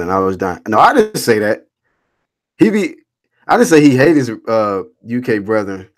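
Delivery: ordinary talk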